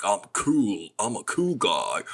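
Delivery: a hint of laid-back cool guy, slow; barely shifted cool-dude voice; lightly voiced cool guy. Shown as a demonstration. Actor demoing his cool guy character voice. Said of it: "It might sound like this".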